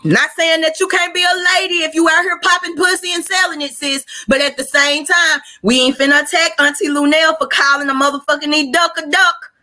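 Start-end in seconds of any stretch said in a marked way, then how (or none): none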